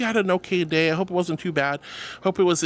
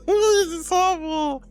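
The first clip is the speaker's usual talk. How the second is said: playful whining; 4 semitones higher than usual. Pretend. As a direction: wail at chat as the teased victim. Whining about being teased to death.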